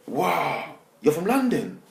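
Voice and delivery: high, mock-amazed gasping wonder; amazed kids. School kids hearing he is from London, mock amazement. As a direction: imitate awestruck kids, pitched right up.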